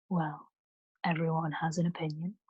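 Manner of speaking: quiet, quick brush-off with mild amusement; dismissive, amused. Acted. Boatman brushing off the priest, softly dismissive and amused. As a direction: lightly shrug off the priest's point. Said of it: "He sounded dismissive, almost amused".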